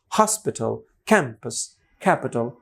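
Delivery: "Hospital, campus, capital" each stressed on its first syllable. Shown as a demonstration. Teacher summing up the stress rule, first-syllable stress. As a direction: punch the opening syllable of "Hospital", "campus" and "capital", relax the rest. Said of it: "সবগুলোর শুরুতে stress".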